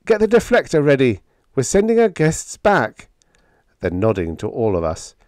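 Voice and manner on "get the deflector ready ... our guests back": high, loud, brisk command; calling an order. Acted. Biggs to the engine room below, calling a brisk order.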